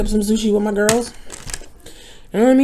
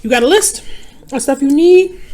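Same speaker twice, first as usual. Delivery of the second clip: quoting friend; 5 semitones higher, 5 dB louder than usual. Retold or imitated. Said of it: "she's like".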